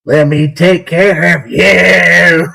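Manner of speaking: slow, raspy creepy croon; creepy old smoker. Retold or imitated. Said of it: "he sounds like a creepy old smoker".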